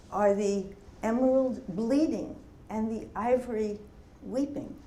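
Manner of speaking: deliberate poet's delivery, phrase by phrase; poetry reading. Acted. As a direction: read the poem aloud, letting each question hang. Reads own poem aloud to an audience.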